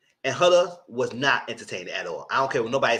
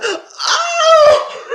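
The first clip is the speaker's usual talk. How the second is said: a sudden squealed oh, much higher and louder; shocked gasp; 12 dB louder than usual. Retold or imitated. Hannah's shocked oh at the recoupling, much higher. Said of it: "And Hannah said".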